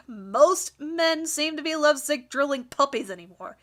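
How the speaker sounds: ordinary talk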